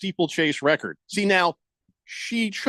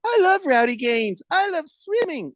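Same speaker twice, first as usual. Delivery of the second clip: higher, slower dreamy fan voice; mock superfan gushing; 11 semitones higher than usual. Imagined listener gushing over Rowdy Gaines, mock starstruck fan. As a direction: gush like a starstruck swimming fan. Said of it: "one person in our listening audience is like".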